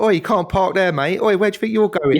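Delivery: high, loud "Oi" like a car-park attendant; mock shout. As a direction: yell it like a grumpy jobsworth. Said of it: "he comes along and he's like".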